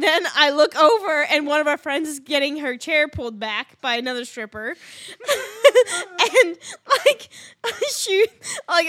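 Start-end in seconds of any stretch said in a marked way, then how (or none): 4.8-8.9 high, loud words broken by laughter; cracking up. Real, not acted. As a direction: struggle to speak through the giggles. Cracking up while telling the story.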